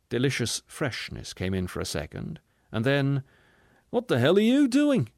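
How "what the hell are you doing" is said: high, loud outraged shout; intelligent man's shout. A narrator's reading. Man objecting to the opened window, shouting. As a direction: shout in alarmed protest. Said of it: "shouted the intelligent man".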